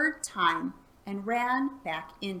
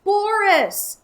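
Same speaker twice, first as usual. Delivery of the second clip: high, loud signal shout; 12 semitones higher, 11 dB louder than usual. Acted. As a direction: yell the name to start the charge. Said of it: "Elmer shouted".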